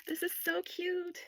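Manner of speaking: high slow soft coo. Real, not acted. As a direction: melt over it slowly. Cooing over cute item.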